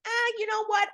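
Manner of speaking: lightly mimicked go-with-the-flow voice; imitated carefree follower. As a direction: toss it off like someone just going along. Voicing followers who go along.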